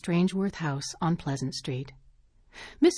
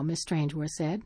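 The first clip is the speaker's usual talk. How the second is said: ordinary delivery, nothing marked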